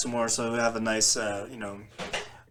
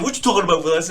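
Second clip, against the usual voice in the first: put-on high, quick child-star catchphrase; punchy TV catchphrase, pitched up; Gary Coleman; 4 semitones higher, 3 dB louder, much faster than usual. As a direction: imitate the sitcom kid's catchphrase accent. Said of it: "even the Gary Coleman, like, accent".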